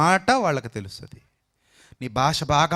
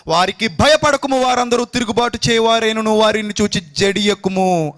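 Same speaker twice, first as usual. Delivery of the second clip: booming proclamation; 9 semitones higher, 6 dB louder than usual. Acted. Preacher proclaiming God's promise to the prophet, loud and high.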